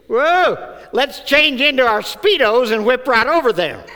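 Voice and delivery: high, loud, laughing mock excitement; excited shepherds. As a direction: ham up the eager shepherds. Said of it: "they said".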